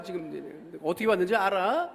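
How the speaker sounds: ordinary talk, nothing marked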